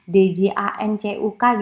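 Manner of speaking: "D J A N C U K" spelled letter by letter. Shown as a demonstration. Lecturer spells out "D J A N C U K" letter by letter for the students. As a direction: spell out "D J A N C U K" one letter at a time. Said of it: "gitu ya di Surabaya".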